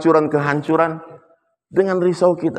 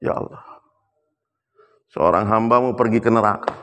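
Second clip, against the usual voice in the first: grieving prayer; 5 semitones lower than usual. Retold or imitated. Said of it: "Umar sedih, menangis".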